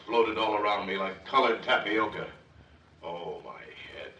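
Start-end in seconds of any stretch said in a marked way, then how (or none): 3.0-4.1 slow, much softer pained moan; dazed groan. Acted. Detective reeling from a blow to the head, slow groan. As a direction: moan as if the stars still float.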